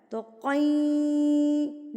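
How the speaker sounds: قین held long through the nose; drawn-out nasal ghunna. Shown as a demonstration. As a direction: hum the nasal sound into قین. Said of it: "ناک میں آواز چلی گئی".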